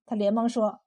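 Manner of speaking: ordinary delivery, nothing marked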